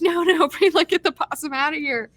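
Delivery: high, laughing mock protest; laughing Gavin quote. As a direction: toss it off high and giggly.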